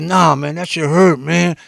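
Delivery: hurt friend. Retold or imitated. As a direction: voice the brother still stung by the loss. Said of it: "He said".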